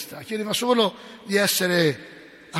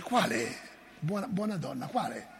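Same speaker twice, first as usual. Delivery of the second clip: gentle Jesus; 9 dB quieter than usual. Retold or imitated. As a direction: ask her gently, almost under the breath. Jesus answers mother gently.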